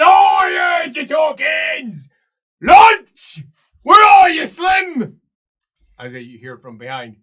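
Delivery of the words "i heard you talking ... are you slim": slow, high, loud ogre shout; dim ogre bellow. Acted. Ogre hunts for the party, slow bellowing. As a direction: boom it out, stupid and hungry. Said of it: "It says".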